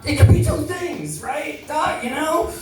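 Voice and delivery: pitched well up, coaxing the doctor; patient bargaining with his doctor, high and hopeful; high hopeful plea. Retold or imitated. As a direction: wheedle the doc for another answer. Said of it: "And I'm like".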